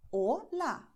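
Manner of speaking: "Hola" with pure O and A, silent H; native Spanish pronunciation. Shown as a demonstration. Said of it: "what a native Spanish speaker would say".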